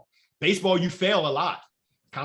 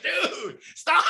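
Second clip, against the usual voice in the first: much higher, half-laughing dude stop; mock exasperated; 11 semitones higher than usual. To his son who keeps cursing, high mock exasperation. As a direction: pretend to scold the son through a laugh.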